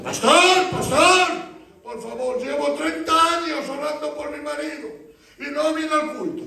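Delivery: high, pleading mock sobbing; imitated tearful plea. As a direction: beg the pastor as if in tears, pitched high. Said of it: "me dicen llorando". (súplica llorosa imitada, aguda)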